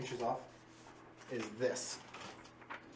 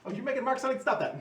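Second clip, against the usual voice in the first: high exasperated protest; 11 semitones higher, 8 dB louder, faster than usual. Real, not acted. GM stopping player marking map.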